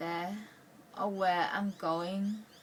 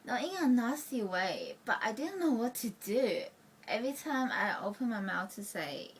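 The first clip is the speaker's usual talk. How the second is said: ordinary talk, nothing marked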